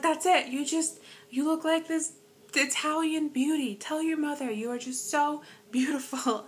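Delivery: put-on high, loud gushing old lady voice; excited old lady. Miss Rita declaring her an Italian beauty, high loud excited gushing. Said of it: "she's like".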